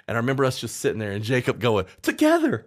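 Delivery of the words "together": sudden high, loud burst; a squeaky, excited cry of the word; excited exclaim. Retold or imitated. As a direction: blurt it out high and thrilled. Jacob's excited one-word interjection quoted.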